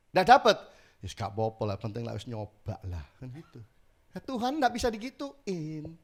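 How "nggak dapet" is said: mock exclaim. Mock shrug at failed request.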